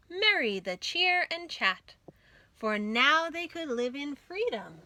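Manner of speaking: ordinary delivery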